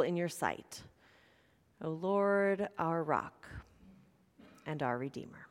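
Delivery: low, soft, hushed prayer voice; quiet opening prayer before the sermon. Acted.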